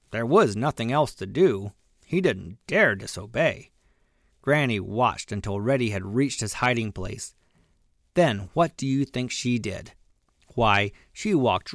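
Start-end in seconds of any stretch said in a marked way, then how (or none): none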